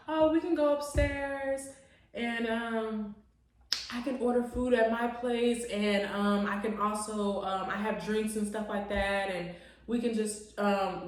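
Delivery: mock his offhand invitation, quietly and evenly; imitating low-key date. Date invites her upstairs to order food, imitated low-key.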